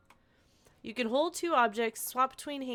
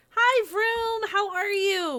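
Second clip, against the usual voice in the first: cheery greeting; 11 semitones higher, 6 dB louder, much slower than usual. Real, not acted. Greeting a viewer.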